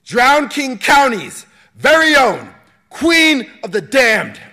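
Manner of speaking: high, loud, slow ring announcer; arena announcer hype. Acted. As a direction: stretch it out for the roaring crowd. Announcer introduces champion.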